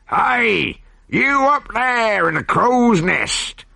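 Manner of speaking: long drawn-out hail to the lookout; calling up. A narrator's reading. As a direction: call up to the crow's nest. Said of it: "he called to the pirate on lookout".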